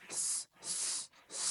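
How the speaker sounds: সা as a voiceless hiss of air; breathy hiss, almost silent; air only. Shown as a demonstration. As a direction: let the air out for সা. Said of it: "বাতাসটা দেখছেন?".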